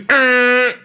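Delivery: high, sustained horn blare; interrupting air horn noise; imitated air horn. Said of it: "blowing air horns".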